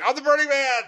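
high, hammed-up proclamation; over-the-top high-pitched wail; mock dramatic cry. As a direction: cry it out like a manic cartoon hero. Acting out burning man's cry.

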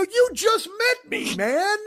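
very high, drawn-out retold protest; slow, squeaking incredulity at a new acquaintance; exasperated disbelief. Answering the ace's question about darts, exasperated.

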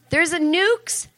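high, slow, scoffing disbelief; mock incredulous. Parents brushing off nukes before having a child, mock incredulous. Acted parents dismissing the nuke worry.

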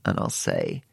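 normal talking voice

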